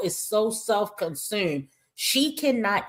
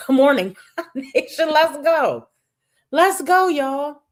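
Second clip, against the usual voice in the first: laughing, much higher and louder, pumped up; laughing hype greeting; 8 semitones higher, 6 dB louder than usual. Real, not acted. Host greeting her viewers, laughing and hyped. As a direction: laugh through it and hype the crowd up.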